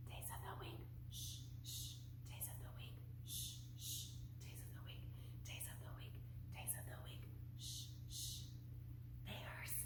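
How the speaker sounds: breathy hushed whisper, no voice at all. Shown as a demonstration. Teacher leading the preschoolers in a whispered song, whispering. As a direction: hush the class with a secret whisper. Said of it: "Let's do whisper voices".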